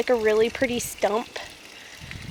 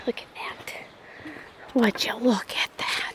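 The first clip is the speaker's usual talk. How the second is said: awed whisper; 6 dB quieter than usual. Real, not acted. Awed at the view.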